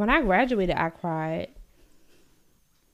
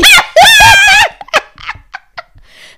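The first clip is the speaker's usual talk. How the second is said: very high, loud laughter; loud shrieking laugh; 17 dB louder than usual. Real, not acted. Friend joking about making the groom cry, shrieking laugh. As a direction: burst out in squealing laughter.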